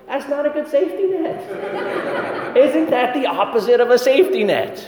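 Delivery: high-pitched, laughing retort, slowed. Real, not acted. Imagined retort to the colonel, laughing.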